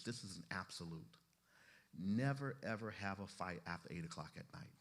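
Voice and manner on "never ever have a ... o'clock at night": strong stress on "Never", dropping back afterwards; emphatic rule. Real, not acted. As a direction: restate the rule with a punched "Never". Repeats the absolute rule emphatically.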